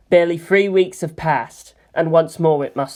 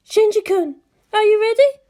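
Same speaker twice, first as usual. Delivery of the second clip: much higher woman's voice; high Misato; 3 dB louder than usual. Acted. Misato checks on the pilot before launch, voiced high. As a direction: ask the pilot over the radio.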